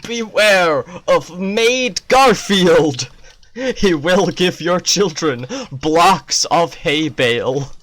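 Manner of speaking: loud, slow mock public warning; a hammed-up neighborhood watch warning; warning announcement. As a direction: read it like a grave notice. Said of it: "neighborhood watch poster that's like".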